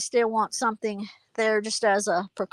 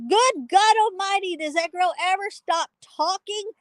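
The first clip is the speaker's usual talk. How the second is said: exasperated husband; 7 semitones higher than usual. Retold or imitated. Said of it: "he's like".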